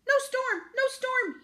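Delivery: high, loud duck squawk; quacking duck. A narrator's reading. Duck reporting from the window, quacking. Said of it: "he quacked".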